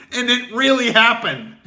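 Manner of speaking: high and loud, bursting with disbelief; incredulous excitement. Real, not acted. Comic marveling at the story with loud incredulous excitement. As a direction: hit it like you can't believe it.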